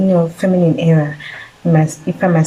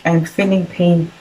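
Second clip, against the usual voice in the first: normal talking voice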